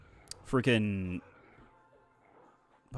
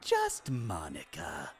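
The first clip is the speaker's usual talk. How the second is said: slow, softer character voice; Monika voice; 5 semitones lower, 6 dB quieter, much slower than usual. Acted. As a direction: breathe it out slowly as Monika. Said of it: "That's one of my favorite ones".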